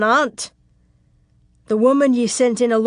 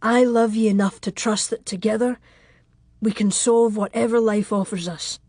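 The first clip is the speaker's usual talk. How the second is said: heartfelt, husky lover's vow; tender, earnest, emotion-filled declaration. Acted. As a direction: confess love in a character man's voice. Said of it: "his voice husky with emotion".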